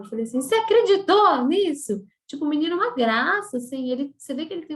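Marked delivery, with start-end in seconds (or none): 0.4-2.0 loud, high, incredulous retort; retold indignant challenge, pitched up and loud; indignant outburst. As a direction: burst out in outraged disbelief. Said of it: "eu fiquei tão brava".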